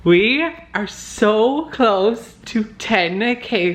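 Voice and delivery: high, slow and drawn out with excitement; thrilled. Real, not acted. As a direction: savor the news, voice lifting high. Nearly hitting ten thousand subscribers.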